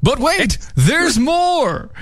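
high, booming TV pitchman; infomercial. Pretend. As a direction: sell it like a late-night ad. Host mimics a TV sales pitch.

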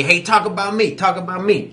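imitated demanding heckle; mock heckler yell. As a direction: pester for attention like a heckler. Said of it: "He started yelling at me".

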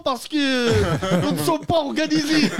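voix de mafieux aiguë, lente et surjouée; yakuza algérien parodique. Pretend. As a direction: joue un yakuza du bled, en traînant les mots. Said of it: "C'est la branche algérienne de yakuza". (parodie de yakuza algérien, lente et perchée)

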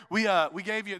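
ordinary talk

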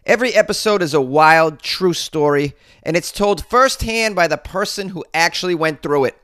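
a thick Boston accent, read ad-style; podcast ad read in a Boston accent. Real, not acted. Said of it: "I know you like my Boston accent".

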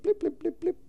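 high mock keypad beeps; keypad blips. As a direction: beep out the button tones. Imitating keypad tones.

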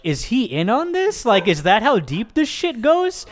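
high, worried questioning; alarmed suspicion. Retold or imitated. His own reaction to the stranger taking the bag, high and alarmed. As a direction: pitch up with sudden alarm. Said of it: "I'm like".

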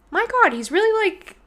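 high, breathless amazement; amazed. Retold or imitated. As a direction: gasp it out in awe. Amazed at partner's running.

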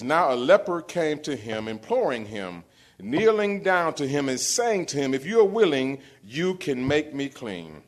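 loud, high full-force preacher; preaching. Real, not acted. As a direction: rouse the church from the pulpit. Reading scripture as preaching.

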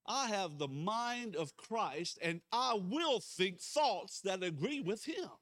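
slow, loud leading of a congregation; declaration of faith. Real, not acted. Pastor leading a repeated confession of faith, bold declaration. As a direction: declare it boldly, word by word.

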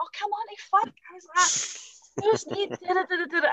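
high-pitched retold rant; exasperated outburst. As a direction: lose your cool, acting out the exasperation. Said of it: "I wasn't as calm as that".

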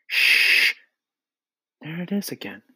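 urgent shush, then whisper; hushed whisper. A narrator's reading. Listening for the faraway voice, urgent whisper. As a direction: hush everyone and whisper.

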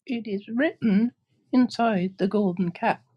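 very high, tiny mouse squeak; squeaky little voice. Acted. Queen of the Mice offering help, high squeak.

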